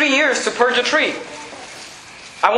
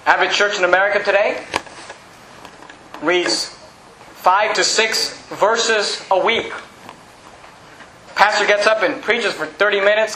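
usual speaking voice

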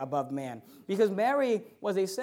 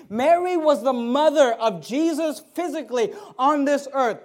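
raised, loud, emphatic declaration; full-force preaching; 4 semitones higher, 6 dB louder than usual. Real, not acted. Preacher declaring who Jesus is, loud full-force preaching. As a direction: hammer each phrase out to the room.

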